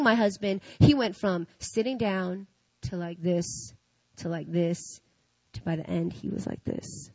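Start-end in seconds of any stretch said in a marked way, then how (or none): 6.1-7.1 hushed. Retold or imitated. Husband's posture sinking lower.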